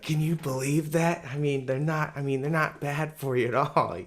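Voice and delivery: high, loud, excited imitation of a friend; fired-up friend. Friend insisting cigars aren't bad for you, imitated all fired up. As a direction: mimic him all worked up and insistent. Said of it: "he's all fired up".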